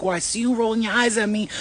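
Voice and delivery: ordinary delivery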